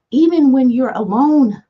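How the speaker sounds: emphatic. Acted. Repeats phrase for emphasis, louder.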